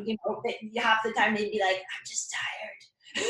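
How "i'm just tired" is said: slow, breathless whisper of "I'm just tired"; a slow, soft, faked-weak whisper; feeble whisper. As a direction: play a limp player murmuring he's only tired. Injured player feigning exhaustion.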